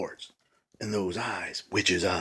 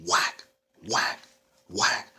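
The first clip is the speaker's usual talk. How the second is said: whack sounds; much slower than usual. Acted. Broom hits.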